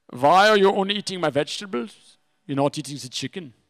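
lightly voiced German chef, questioning; German chef accent. German chef quizzing the comedian about his plate, light German accent. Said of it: "the big German chef".

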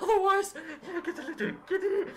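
very high, loud, hammed-up old-woman voice; giddy granny shriek dissolving into a giggle; old lady character. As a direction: play a dotty old lady in screechy falsetto. Plays the drugged Missus Drake.

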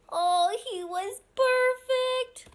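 high, loud, drawn-out joy; stretched-out, high delighted exclamation; gushing delight. Acted. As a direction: gush slowly over the perfect pet. Delight at the puppy.

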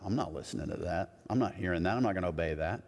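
low, soft under-the-breath refusal; muttered defiance. Retold or imitated. Kids answering screaming parents, imitated low defiant mutter. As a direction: mutter it like a kid tuning out.

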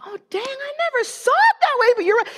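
sudden dawning wonder; amazed realization. Retold or imitated. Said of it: "I was like".

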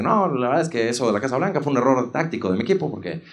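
low, soft excuse-making; hushed, halting low voice; politician impression. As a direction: imitate a statesman explaining away a blunder. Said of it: "Y entonces dice eso".

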